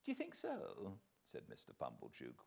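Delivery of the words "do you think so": much higher, softer chuckling question; laughing. Acted. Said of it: "with his former laugh".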